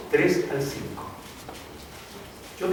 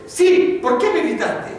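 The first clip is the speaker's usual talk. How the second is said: louder, higher scolding repeat; más fuerte; 12 semitones higher, 6 dB louder, slower than usual. Retold or imitated. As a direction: bark it back even harder at the boy. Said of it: "le volvía a decir más fuerte". (padre repitiendo más fuerte al hijo, en voz alta y aguda)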